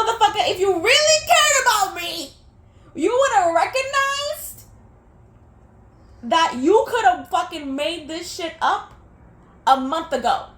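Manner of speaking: real rage, shouted; furious rant. Real, not acted. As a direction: tear into the ex. Angry rant at her ex.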